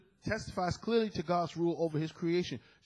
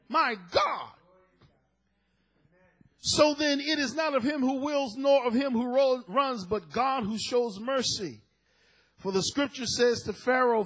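preaching; 9 semitones higher, 6 dB louder than usual. Acted. Preaching continues with fervor.

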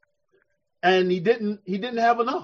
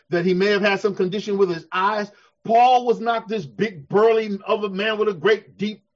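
usual speaking voice

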